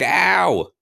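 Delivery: hammed-up yelping, easing into high complaint; pained yell. God zapped by his own lightning, hammed-up pained yelling. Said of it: "I yell out".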